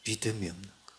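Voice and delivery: normal talking voice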